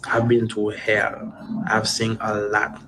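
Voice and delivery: ordinary talk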